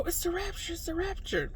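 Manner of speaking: high, soft, urgent telling; retold excited half-whisper to a sister; hushed excitement. Dreamer telling the sister it's the rapture, hushed excitement.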